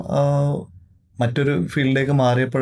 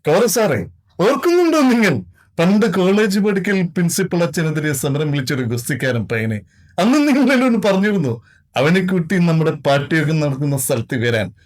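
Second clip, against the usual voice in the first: higher, louder dramatic movie-dialogue impression of a veteran actor; mimicked film actor; 6 semitones higher, 3 dB louder than usual. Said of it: "എന്നാ ശബ്ദം ചെയ്യല്ലേ?". (ഉയർന്ന ശബ്ദത്തിൽ ഉച്ചത്തിൽ cinema നടന്റെ dialogue അനുകരണം)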